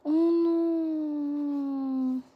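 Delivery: high, loud drawn-out oh no; loud high overwhelmed cry; overwhelmed squeal. Real, not acted. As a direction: wail it in fangirl agony. Reacting to Taekook photocard.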